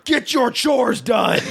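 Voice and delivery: high, slow shouted heckle; retold yell. Friend retelling his buddy yelling from the car, shouting. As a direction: shout it at the man mowing. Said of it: "leaned his full body out of the car and yelled".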